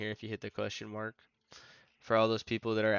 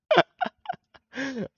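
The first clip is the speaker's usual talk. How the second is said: loud high cackle; high burst of laughter; 9 dB louder than usual. Real, not acted. Laughing at own joke.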